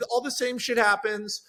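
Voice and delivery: ordinary talk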